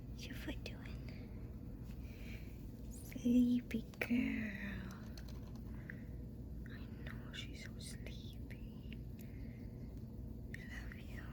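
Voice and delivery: soft unvoiced whisper to a sleepy dog; whispering to a pet. Real, not acted. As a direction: breathe it gently so she stays asleep.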